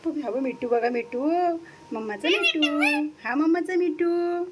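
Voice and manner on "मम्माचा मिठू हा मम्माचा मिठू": higher, sing-song coaxing voice; pet talk. Real, not acted. Mother coos to her parrot Mithu while cooking, in a high sing-song voice.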